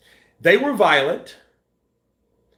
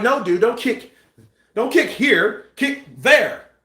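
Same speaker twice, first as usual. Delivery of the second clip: raised-pitch pleading instruction; insistent, higher retold warning; mock urgent correction; 6 semitones higher, slower than usual. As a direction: act out a crew member setting him straight. Acting crew correcting Van Damme.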